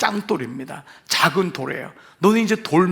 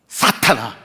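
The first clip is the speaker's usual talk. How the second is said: mock stern rebuke; 6 semitones lower, slower than usual. Pastor mimics calling congregation Satan.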